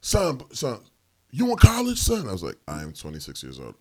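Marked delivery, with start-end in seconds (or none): 0.0-2.3 high, urgent questioning; imitating an excitable stranger; over-invested stranger. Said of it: "he was so invested". 2.7-3.8 quiet, low deadpan; deadpan correction. Acted. His dry answer to stranger.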